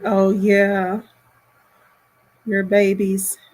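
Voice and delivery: usual speaking voice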